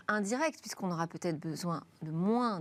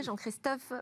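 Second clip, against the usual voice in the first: put-on name said with a playful English accent; mock English accent; 4 semitones higher, 3 dB quieter than usual. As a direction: Anglicize the name, lightly hammed up. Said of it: "Avec mon meilleur accent anglais".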